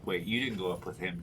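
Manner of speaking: ordinary talk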